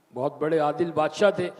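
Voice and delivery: ordinary talk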